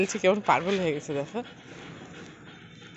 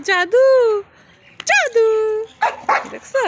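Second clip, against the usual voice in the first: rising, louder sing-song calls to the pet; high coaxing call; 14 semitones higher, 9 dB louder, slower than usual. Real, not acted. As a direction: coax the pet with a high eager voice. Calling pet by name.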